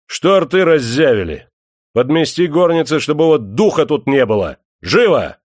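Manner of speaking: loud, high scolding shout; angry shouting. A narrator's reading. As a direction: yell orders at the servants. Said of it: "крикнул Демидов слугам".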